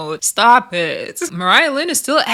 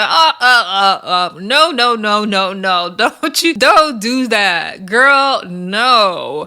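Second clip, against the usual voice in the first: put-on louder, pleading no no girl; calling out no; 4 dB louder, much slower than usual. To the cast member wanting to fight Suki, a loud plea. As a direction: wave her off with a loud no.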